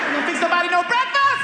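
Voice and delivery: loud, high-pitched challenge; defiant shout. Retold or imitated. Son talking back to his mother in church, shouted challenge. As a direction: holler the demand, voice way up.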